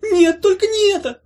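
very high, loud wailing moan; terrified box moaning; moaning creature. Acted. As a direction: wail in dread like a trapped little creature. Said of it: "застонала коробка".

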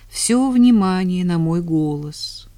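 ordinary delivery

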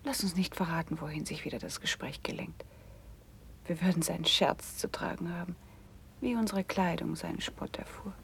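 conspiratorial whisper to her friend; secret whisper. Acted. Sister whispers to Eleonore as her brother approaches, hushed. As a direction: hush it so the brother won't hear.

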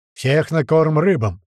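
slow, loud battle yell. Acted. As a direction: roar it out. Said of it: "не отстаёт от него".

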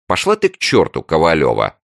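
high, loud playful rebuff; playful brush-off. A narrator's reading. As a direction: shoo her off fondly. Friendly brush-off.

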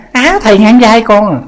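teasing a short monk, lightly voiced; condescending lady. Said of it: "Bà còn đi tới".

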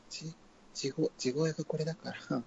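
trying natural voice. Shown as a demonstration. Trying a female voice in natural pitch, softly. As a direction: practise quietly in natural voice.

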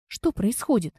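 ordinary delivery

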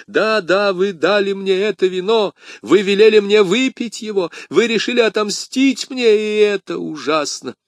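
high, agonised dying man's outcry; anguished accusation. Acted. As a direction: wail at your poisoner. Dying man accuses his poisoner.

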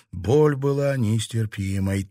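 ordinary delivery

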